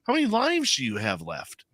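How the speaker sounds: teasing. Retold or imitated. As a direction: tease her like she's indestructible. Teasing mom about many lives.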